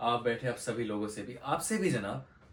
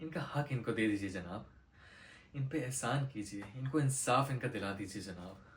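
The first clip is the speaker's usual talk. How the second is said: softer, low, earnest appeal; subdued plea; 3 semitones lower, 5 dB quieter than usual. Acted. Lawyer asking the judge to give these people justice, pleading. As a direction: ask humbly on their behalf.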